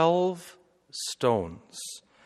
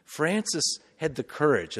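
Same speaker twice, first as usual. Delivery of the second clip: ordinary talk, nothing marked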